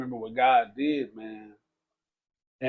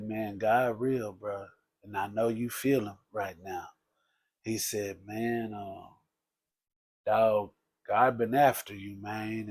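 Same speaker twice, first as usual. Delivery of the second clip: a hint of a quiet street preacher, softer and lower; hushed, low counsel; 5 semitones lower, 7 dB quieter than usual. Man counselling the narrator, low and hushed. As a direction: speak it low and close, like a secret.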